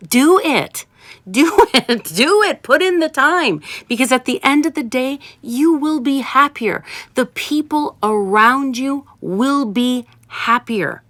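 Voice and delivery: urgent encouragement. Real, not acted. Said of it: "coaching listeners to put in time".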